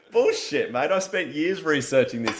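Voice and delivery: high, loud, laughing retort; laughing mock protest. Retelling his reply to Russ, laughing high and loud. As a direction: protest through laughter, half joking.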